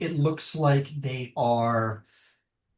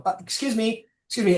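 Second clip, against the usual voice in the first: polite call; 8 semitones higher, faster than usual. Retold or imitated. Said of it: "lean over the side and go".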